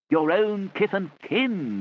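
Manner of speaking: slow, high, drawn-out plea; mock pleading. Children plead with the pretend Uncle G, mock earnest. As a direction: ham up an over-earnest plea. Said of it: "plead with her and say".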